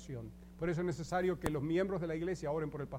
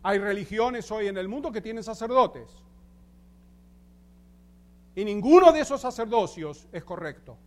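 preaching; 3 semitones higher, 8 dB louder than usual. Acted. Pastor preaching to the congregation about priesthood, emphatic and loud. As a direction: drive each point home from the pulpit. Preaching doctrine with rising force.

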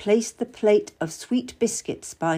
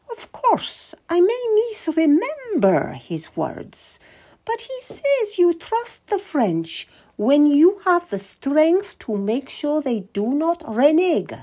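much higher, slow, falsely modest; coy queen; 8 semitones higher, 3 dB louder, slower than usual. Acted. Queen pretending to misremember the king's words, higher and coy. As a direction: feign a faulty memory, sweetly.

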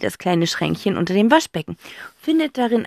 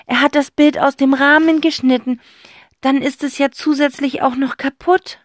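put-on boy voice worried; 7 semitones higher, 5 dB louder than usual. Zack worried.

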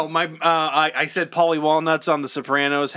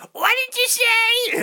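very high, drawn-out mother voice; high-pitched mock mom; mimicked mother. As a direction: mimic Ma calling back in a slow, high screech. Mimics mother not hearing him.

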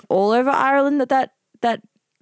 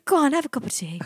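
higher, badly put-on Irish; bad Irish accent; 6 semitones higher, faster than usual. Co-host offering tea, badly faked Irish accent. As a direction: attempt a homely Irish lilt and miss. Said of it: "that's really bad".

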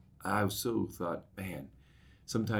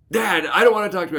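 higher, louder, fast mock of a sulking daughter; upset daughter; 12 semitones higher, 10 dB louder, faster than usual. Daughter refusing her father through the door, higher, louder and fast. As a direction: shoot him down quickly, exasperated.

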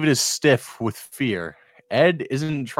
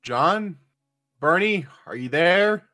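raised voice calling through a door; 7 semitones higher, 5 dB louder than usual. Acted. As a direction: call to someone inside.

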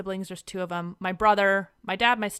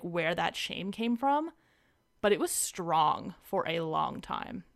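ordinary talk

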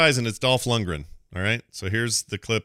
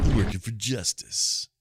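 slow, lightly imitated action-star line; a hint of an action-hero impression; Dolph Lundgren; slower than usual. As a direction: mimic the movie line, slowly. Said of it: "This sounds like Dolph Lundgren".

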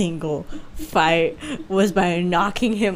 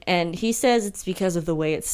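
ordinary talk, nothing marked

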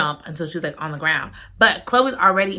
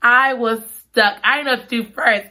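normal talking voice

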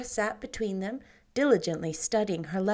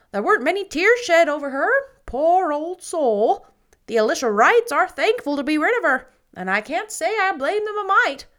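put-on much louder, higher, opinionated old neighbor; gossiping matron; 8 semitones higher, 10 dB louder than usual. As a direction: hold forth like the village busybody. Mrs Lynde gossips.

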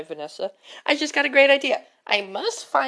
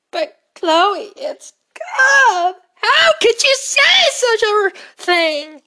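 whiny pleading; 10 semitones higher, 7 dB louder than usual. Acted. Pleading over gum.

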